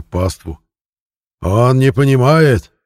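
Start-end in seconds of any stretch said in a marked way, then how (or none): 1.4-2.7 slow, loud preacher's cry; voice soaring. A narrator's reading. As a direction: raise it up to the rafters. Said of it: "Голос проповедника взлетел под крышу".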